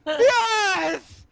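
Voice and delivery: ecstatic high shout; gasp, then scream it skyward; loud, high cry of triumph; screaming to the heavens. Acted. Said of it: "Screaming into the heavens".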